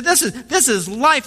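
"this is life" high with a rising lift; lightly imitated excited baker; fervent baker. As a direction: lift "this is life" up in eager awe. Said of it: "He said".

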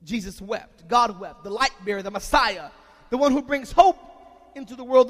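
normal talking voice

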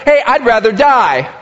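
loud, high, angry outburst; Jonah's anger. Acted. Jonah so offended at God he'd rather die, loud and angry. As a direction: throw it at God in a loud huff. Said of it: "No, that's anger".

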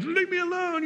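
mock-whine the brush-off; fed-up dad. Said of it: "that kind of thing".

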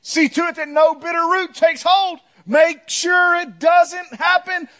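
building to a loud high shout; preaching shout. Acted. Preacher warning church about bitterness, rising to a shout. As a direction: drive the warning home with volume.

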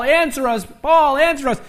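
high, pleading chant of the prophets; mock prophets' wailing plea; crying out to Baal. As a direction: call up to the sky, hopeful. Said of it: "crying out to Baal".

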